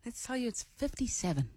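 hushed clue-giving voice. Pretend. Radio co-host revealing the number to listeners, soft clue voice. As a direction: reveal it very softly, as a secret clue. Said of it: "don't do the clue voice".

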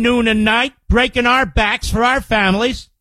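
ordinary delivery

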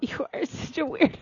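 hushed, whispery jab; teasing mutter. Real, not acted. Co-host teasing him over the seashell, hushed. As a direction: say it under your breath, half whispered.

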